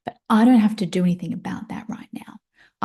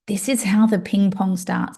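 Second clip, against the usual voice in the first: ordinary delivery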